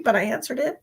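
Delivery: ordinary talk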